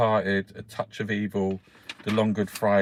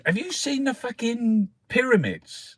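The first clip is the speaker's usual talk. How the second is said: mock tourist; 13 semitones higher than usual. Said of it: "Someone was saying".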